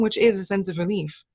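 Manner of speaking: ordinary delivery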